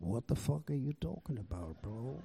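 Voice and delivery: very soft, low grumble; baffled aside. Retold or imitated. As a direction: say it under your breath, baffled. Retold baffled private reaction.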